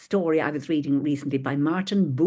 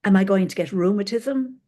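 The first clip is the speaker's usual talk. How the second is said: heartfelt reading of a worried poem; 4 semitones higher, 3 dB louder than usual. Acted. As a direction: read the poem with feeling. Reads Mary Oliver poem.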